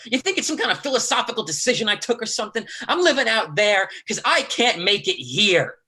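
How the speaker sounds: sharp, rising anger at his brother; angry outburst. Acted. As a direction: rail at him, high and fast. Brothers quarrel, Lee angry.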